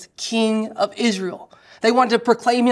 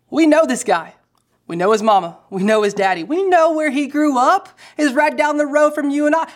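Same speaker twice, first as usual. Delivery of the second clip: scoffing crowd; 6 semitones higher, 3 dB louder than usual. Retold or imitated.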